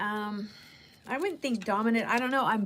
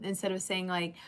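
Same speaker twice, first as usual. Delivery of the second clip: normal talking voice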